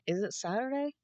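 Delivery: ordinary talk, nothing marked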